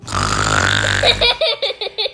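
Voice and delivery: rough, drawn-out pretend snore; imitated snore. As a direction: snore noisily as if fast asleep. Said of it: "he's sitting there snoring".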